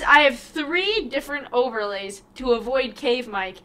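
normal talking voice

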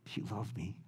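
Jesus quietly asking Peter, near hushed; hushed tender question. Retold or imitated. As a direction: barely voice it, tenderly. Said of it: "He asked him one question".

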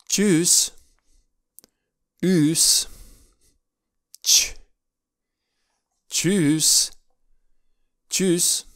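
Tschüss with a clipped ü and a hiss of ss; German Tschüss. Shown as a demonstration. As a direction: say Tschüss short with a sharp tsch. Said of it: "Apoi avem". (Tschüss scurt, cu tsch clar)

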